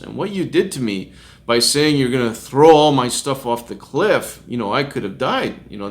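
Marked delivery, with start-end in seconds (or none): none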